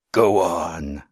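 loud, booming ghoul croak; thunderous croak. A narrator's reading. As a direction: thunder it like a grotesque monster. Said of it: "thunderously croaked the ghoul".

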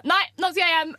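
high, indignant retold protest; tantrum outburst. Drunk girlfriend insisting to friends she is leaving, high retold tantrum.

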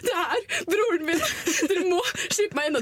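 crying plea. Retold or imitated. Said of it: "så begynner jeg å gråte".

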